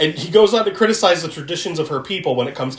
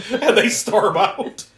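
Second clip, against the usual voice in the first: ordinary talk, nothing marked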